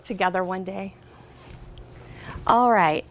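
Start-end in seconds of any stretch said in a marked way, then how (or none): none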